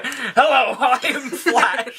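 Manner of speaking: high, slow, giggly self-introduction; laughing mock greeting. Host mocks hero revealing his identity, laughing. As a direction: play the hero while cracking up. Joking impression while laughing.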